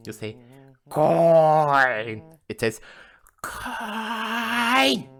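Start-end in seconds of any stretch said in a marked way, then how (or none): 0.9-2.2 high, loud, long-held bellow; crazed drawn-out yell; shouting. Acted. Escalating shouting exchange with coin. 3.4-5.1 very high, rising long screech; swelling piercing cry; coin screeching. Acted. Coin finally yells back.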